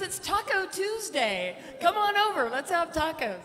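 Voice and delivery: hammed-up Brent inviting to tacos; excited invitation. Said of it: "He goes".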